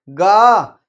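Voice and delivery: गा with a long, loud "aa"; drawn-out syllable. Shown as a demonstration. Teacher showing the sound inside a letter, गा drawn out. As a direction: hold the vowel of गा. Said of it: "तो यहाँ क्या आएगा".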